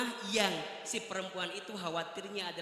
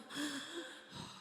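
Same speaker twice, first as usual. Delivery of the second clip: gasp; 6 semitones higher, 9 dB quieter than usual. Retold or imitated. Shocked at the ninth house.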